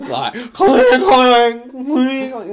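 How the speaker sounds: loud high clanging sound effect; bell clang imitation. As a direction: ring out each clang like a bell.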